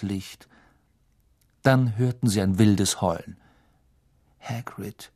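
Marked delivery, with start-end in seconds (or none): 4.4-5.1 a hushed, far-off murmur; soft, stunned murmur. Acted. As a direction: breathe the name quietly, to himself. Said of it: "murmelte Harry".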